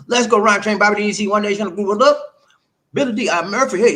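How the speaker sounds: loud, high hype call to the chat; hype shout-out. Real, not acted. Streamer hyping up his chat viewers, loud and high. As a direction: pump up the names like a host.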